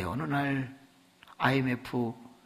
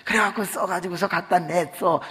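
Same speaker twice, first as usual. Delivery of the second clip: ordinary talk, nothing marked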